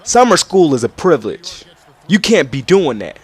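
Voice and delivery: loud, high scolding teacher; mock a stern teacher telling off a kid; loud high scolding imitation of the teacher. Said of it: "the teacher had came up to me and said".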